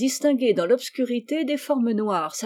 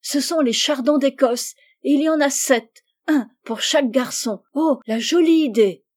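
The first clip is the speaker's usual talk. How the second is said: high, louder, thrilled exclaiming; delighted excitement; 5 semitones higher, 5 dB louder than usual. A narrator's reading. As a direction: marvel at each discovery. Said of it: "dit Rose en battant des mains". (enthousiasme ravi, voix haute)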